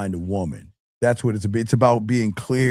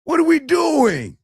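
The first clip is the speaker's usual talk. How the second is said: much higher, louder cry of disbelief; exasperated outburst; 4 dB louder than usual. Real, not acted. Coach scolding the men in chat, high exasperated cry. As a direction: throw your hands up at the men.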